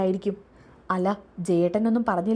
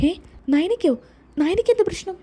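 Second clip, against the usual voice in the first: sudden high alarmed cry; shocked alarm; 11 semitones higher, 4 dB louder than usual. Acted. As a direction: jolt up in panic at the news. Son hears wife hospitalised.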